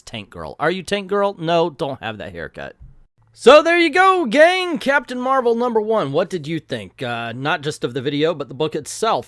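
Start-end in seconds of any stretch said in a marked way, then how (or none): 3.4-6.1 high, booming shout to the audience; exuberantly projected send-off; loud sign-off call. Real, not acted. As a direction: call out big and high to wrap up.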